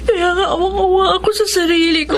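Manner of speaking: umiiyak habang nagsasalita, mataas ang boses; crying. Acted. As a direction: garalgal sa iyak dahil sa awa sa sarili. May pities herself, crying. (si May, umiiyak na nagsusumbong sa kaibigan)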